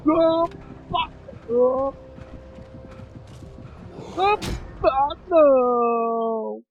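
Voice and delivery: high, loud startled yelps; startled panic. Real, not acted. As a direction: yelp in alarm. Dodging attack in horror game.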